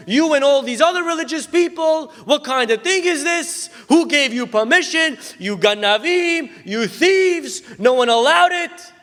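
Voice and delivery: high, loud furious shout at the customers; angry yelling manager. Retold or imitated. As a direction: scold them at full volume, outraged. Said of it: "sorry for screaming at you".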